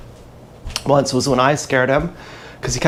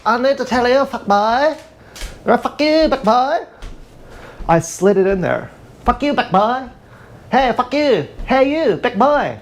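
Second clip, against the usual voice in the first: much higher, sing-song mocking voice cooing at the cat; taunting baby talk; 9 semitones higher than usual. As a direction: tease the cat in a silly high put-on voice.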